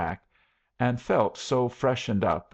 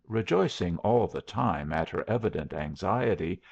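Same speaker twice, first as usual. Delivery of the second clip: ordinary delivery, nothing marked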